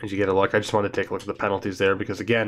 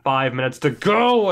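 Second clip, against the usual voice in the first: ordinary talk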